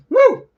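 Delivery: loud high celebratory woo; whoop. Real, not acted. Reader cheering his own mistake, loud whoop. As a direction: let out a big whoop.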